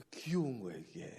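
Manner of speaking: hushed and fond; whisper. Real, not acted. 성도들이 귀엽다고 말하는 다정한 속삭임. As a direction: breathe it out fondly under the voice.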